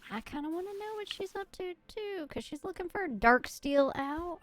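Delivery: high, fast southern twang; southern accent. Real, not acted. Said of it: "I don't know why I am doing a southern accent".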